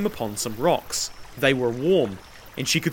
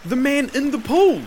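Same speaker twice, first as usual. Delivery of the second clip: high, loud, insistent answer; dogged repetition; 12 semitones higher, 5 dB louder than usual. A narrator's reading. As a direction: repeat it stubbornly to the master. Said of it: "the slave repeated doggedly".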